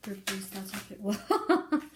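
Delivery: slow, then laughing; breaking into laughter. Real, not acted. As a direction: laugh cuts off the words. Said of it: "you don't even wanna say anymore".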